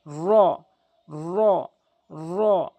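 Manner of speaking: র with the tongue tip curled up and the lips rounded; Arabic ra. Shown as a demonstration. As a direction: round the mouth and roll র. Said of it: "এভাবে উচ্চারণ করবেন".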